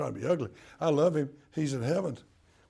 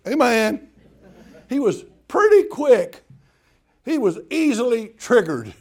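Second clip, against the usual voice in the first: emphatic preaching; 7 semitones higher, 11 dB louder than usual. Real, not acted. Emphatic about father.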